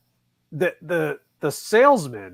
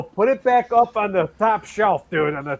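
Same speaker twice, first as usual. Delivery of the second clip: pleading joke; 3 semitones higher, 3 dB louder than usual. Retold or imitated. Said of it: "I go".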